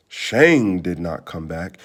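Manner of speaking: usual speaking voice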